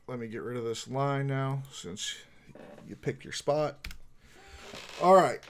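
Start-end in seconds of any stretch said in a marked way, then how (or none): 5.0-5.4 loud announcement. Acted. Game master calls for initiative.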